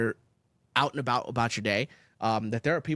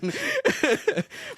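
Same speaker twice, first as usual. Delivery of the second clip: real high laughter. Real, not acted. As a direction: keep laughing. Continued laughter.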